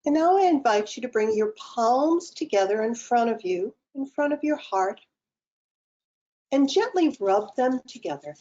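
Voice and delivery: calm, unhurried meditation guide with long pauses; guided meditation. Acted. Guide leading online participants through breathing and self-massage, guided meditation. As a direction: lead them gently into the breath, phrase by phrase.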